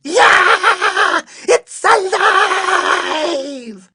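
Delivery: high, full-blast shriek with stretched vowels; panicked long-drawn screaming; terrified scream. A narrator's reading. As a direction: scream in horror, dragging out every word. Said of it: "The man screamed".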